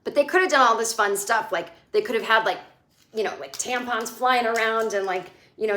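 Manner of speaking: ordinary talk, nothing marked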